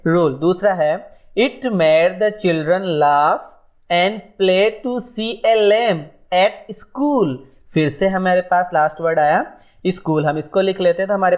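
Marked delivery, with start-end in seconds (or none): 1.4-7.4 very slow, sing-song nursery rhyme recital; slow rhyme reading. Shown as a demonstration. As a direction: chant it out line by line for children. Reads nursery rhyme to class.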